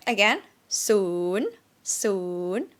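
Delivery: scoop soon down and up; Thai rising tone. Shown as a demonstration. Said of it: "the sweetest tone".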